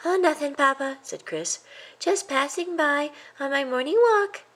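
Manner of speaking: a little mouse playing it cool after being caught; guilty young mouse. A narrator's reading. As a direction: boyish fake innocence. Guilty boy answers papa.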